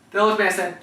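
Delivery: usual speaking voice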